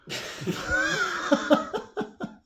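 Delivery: high burst of laughter; real laugh. Real, not acted. Player amused by his goblins' move, laughing.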